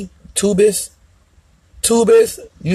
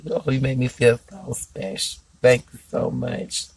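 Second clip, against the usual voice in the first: soft thanks; 4 semitones lower, 7 dB quieter than usual. Real, not acted. Thanking viewer Tony softly.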